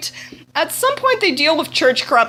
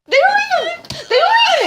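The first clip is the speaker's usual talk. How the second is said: exclaimed. Real, not acted.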